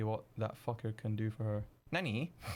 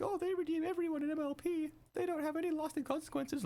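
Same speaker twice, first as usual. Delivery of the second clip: much higher, whiny mock voice of a smug critic. Mocking fans who say the show redeems everyone, high whiny parody. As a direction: parody the complainers in a silly high voice. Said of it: "People who are like".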